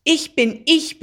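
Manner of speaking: loud, high, defiant shout; roaring. A narrator's reading. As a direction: bellow it, hammering each word. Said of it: "brüllte Jackie". (trotzig gebrüllt)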